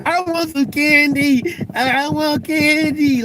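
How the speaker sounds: put-on high, slow whiny child begging for candy; whiny kid. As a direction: drag it out like a kid in a candy store. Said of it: "like kids be in the candy store".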